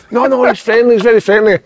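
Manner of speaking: a hint of a breezy dog owner brushing it off; mock dog owner woman. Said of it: "the woman shouts".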